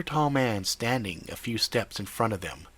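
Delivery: ordinary delivery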